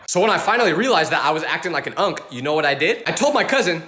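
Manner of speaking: normal talking voice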